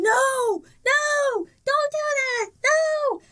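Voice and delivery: very high, mimicked child's cry; imitated night-terror scream. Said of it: "she would scream".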